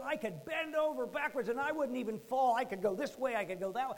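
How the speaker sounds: high, loud, gleeful boasting; retold boyish excitement. Boy bending about in the mud, loud excited retelling. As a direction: show off like a thrilled kid. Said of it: "This was so crazy fun".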